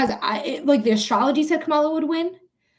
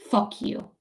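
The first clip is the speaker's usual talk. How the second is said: voiceless, softly spat curse; hushed contempt; 6 dB quieter than usual. Real, not acted. Streamer cursing immigration agents, hushed contempt. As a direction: curse them under your breath.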